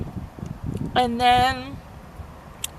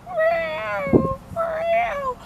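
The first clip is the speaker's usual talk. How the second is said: imitated high, drawn-out meow; cat meowing. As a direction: mimic a trapped cat's long high cries. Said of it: "He's like".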